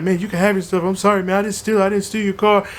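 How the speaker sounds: high, strained apology on the verge of crying; scared, near tears. Retold or imitated. Caught thief apologizing to the owner, frightened and high. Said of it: "freaked out, like, about to cry".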